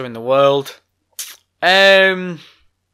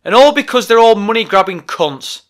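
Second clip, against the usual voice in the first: raised, heated outburst; angry rant; 7 semitones higher, 6 dB louder than usual. Real, not acted. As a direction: let the anger spill over. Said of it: "vented a frustration".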